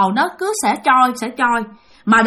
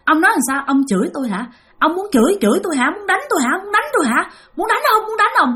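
high, rising challenge that gets higher; possessed yelling; 6 semitones higher, faster than usual. Retold or imitated. Possessed brother yelling at his father from the railing, voice climbing. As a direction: taunt your father, voice climbing. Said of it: "Thì anh mới la, anh mới chửi nó".

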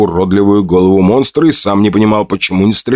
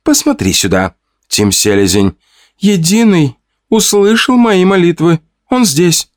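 high, bright joyful woman's voice; rising into a high thrilled cry; joyful exclaim; 10 semitones higher than usual. A narrator's reading. Lola showing Tim the tracks, joyfully. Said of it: "радостно воскликнула".

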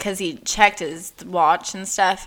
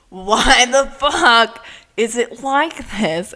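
slow, loud, high exasperation; exasperated outburst; 5 semitones higher, 7 dB louder than usual. Retold or imitated. Podcaster voicing own frustration at sexism, exasperated outburst. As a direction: hammer out each word in frustration.

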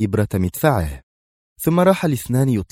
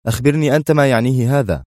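firm, higher-pitched demand; أدهم; 5 semitones higher, 4 dB louder, faster than usual. A narrator's reading. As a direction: put it back to him firmly. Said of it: "يقول بنفس الحزم". (بحزم)

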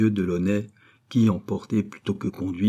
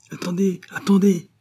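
high cry, louder on the repeat; raised, pressing voice; urgent calling out; 10 semitones higher, 4 dB louder than usual. A narrator's reading. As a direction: call out to stop someone at once. Rescuer urgently stops the prisoner.

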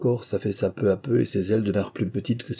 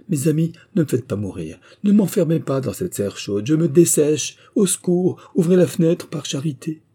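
high, raised cries for help, building; desperate pleading; 8 semitones higher, 5 dB louder than usual. A narrator's reading. Madame Rain drying up in the shut room, desperate pleading. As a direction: beg frantically to be let out.

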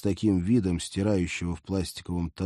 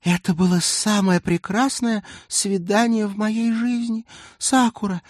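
high, slow, dreamy gushing; 11 semitones higher, 6 dB louder than usual. A narrator's reading. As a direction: swoon over him breathlessly. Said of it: "мечтательно выдохнула".

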